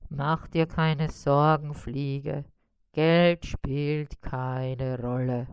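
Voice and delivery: low, slow, easy-going spider voice; grand spider. A narrator's reading. Spider reassuring the fly, low slow voice. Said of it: "unterbrach ihn Karl-Heinz".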